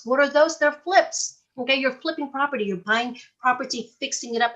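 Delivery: ordinary talk, nothing marked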